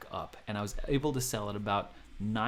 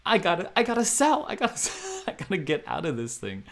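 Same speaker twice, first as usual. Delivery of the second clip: high, loud panicky rush; frantic, half laughing, need to sell; panicked; 7 semitones higher, 6 dB louder than usual. Retold or imitated. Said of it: "and I'm panicked".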